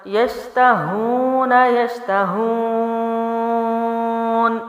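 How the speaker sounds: یشتہون with the و held three alifs long, very slow; three-alif stretch. Shown as a demonstration. Teacher demonstrating a three-alif madd. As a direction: hold the long vowel in یشتہون. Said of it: "واو مدہ کو وقف کی صورت میں تین الف لمبا کیا".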